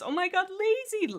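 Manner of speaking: high, delighted, drawn-out squeal; light up at a viewer's name; excited squeal. Real, not acted. Streamer thanks donor Lazy, excited high squeal.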